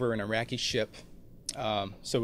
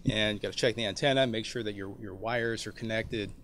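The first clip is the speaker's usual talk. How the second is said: ordinary delivery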